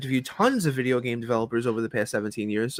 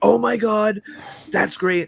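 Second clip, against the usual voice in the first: higher, louder delighted exclamation; excited reaction; 8 semitones higher, 4 dB louder, slower than usual. Retold or imitated. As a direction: imitate impressed listeners bursting out with praise. Developers react delightedly to the demo.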